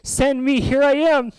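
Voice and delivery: high, loud call to be chosen; eager volunteering cry. Acted. Isaiah stands up and volunteers.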